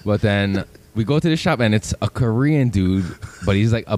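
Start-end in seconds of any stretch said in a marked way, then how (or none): none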